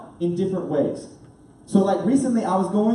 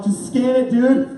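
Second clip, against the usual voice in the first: louder, higher exasperated demand; frustrated; 6 semitones higher, 5 dB louder, slower than usual. Retold or imitated. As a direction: push the slow worker along. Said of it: "kinda frustrated".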